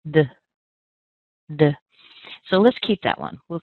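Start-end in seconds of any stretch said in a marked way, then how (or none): 0.1-1.7 "d" clipped to a tiny weak uh; reduced schwa syllable. Shown as a demonstration. Teacher showing the reduced first syllable, short and weak. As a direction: scrunch "d" down to almost nothing.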